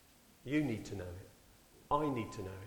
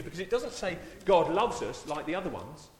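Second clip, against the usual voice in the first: much higher and louder, slowing on is; impassioned preaching; 7 semitones higher, 4 dB louder than usual. Acted. Preacher proclaims God is love to the church, impassioned. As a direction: build it up to a cry.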